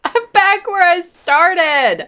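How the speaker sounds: high, slow, loud despairing wail; exasperated whine. Real, not acted. Stuck again in game maze.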